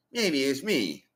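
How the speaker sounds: high, loud put-on Chinese accent; pleading "baby it's me" in a hammed-up Chinese accent; mock Chinese accent. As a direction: ham up a Chinese accent as the dead man back in a new body. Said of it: "accent of the Chinese".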